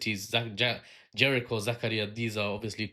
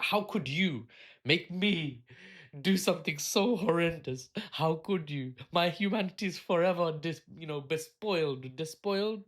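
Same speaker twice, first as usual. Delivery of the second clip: mock victim outrage; 5 semitones higher than usual. Nuke user playing the victim, hammed-up anguish. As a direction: wail in melodramatic mock horror.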